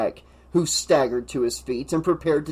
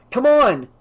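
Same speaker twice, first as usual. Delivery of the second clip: urgent shout; 10 semitones higher, 6 dB louder than usual. A narrator's reading. Urging friend toward a fight.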